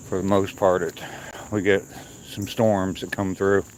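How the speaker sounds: ordinary delivery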